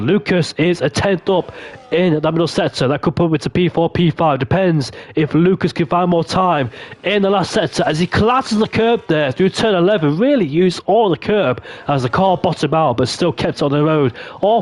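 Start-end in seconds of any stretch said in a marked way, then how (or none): none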